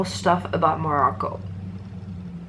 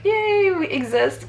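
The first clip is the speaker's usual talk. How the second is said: high, drawn-out little yay; mock cheer; 13 semitones higher, slower than usual. Sharing her country with Japanese people, playful high cheer.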